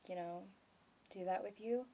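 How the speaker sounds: ordinary talk, nothing marked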